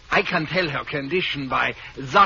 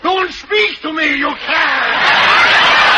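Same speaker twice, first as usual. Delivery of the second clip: high, loud indignant outburst; outraged shout; 10 semitones higher, 9 dB louder, much slower than usual. Acted. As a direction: shout the husband down, furious. Doctor outraged after her scene.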